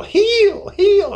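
very high, loud squealed "Heal"; high-pitched squeal. Retold or imitated. Imitating a TV healer praying over people, squealing. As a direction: squeal it like a faith healer striking a head. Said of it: "you would hear this high-pitched squeal".

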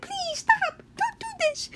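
tiny, very high pleading voice; high, soft mock-pitiful plea; crying branches. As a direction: squeak it softly like a begging little branch. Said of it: "all these crying branches".

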